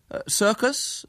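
put-on high, offhand boy's query; careless. A narrator's reading. William answers his rival's circus question, careless. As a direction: feign casual ignorance, pitched high. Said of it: "said William carelessly".

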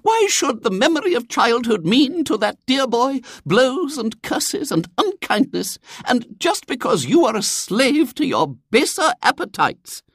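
very high, impassioned lady's tirade; righteous denouncing lady. A narrator's reading. Visitor denounces William's father, righteous. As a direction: harangue like a temperance crusader.

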